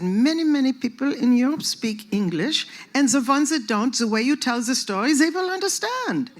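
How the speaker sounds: emphatic German-accented imitation. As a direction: persuade in a put-on German accent. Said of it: "I wanna hear your German accent".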